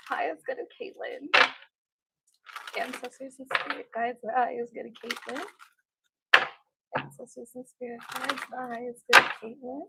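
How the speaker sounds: soft, repeated summoning chant; murmured invocation. Real, not acted. Reader to Caitlyn's ancestors and spirit guides, murmured invocation. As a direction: call on the spirits under the breath.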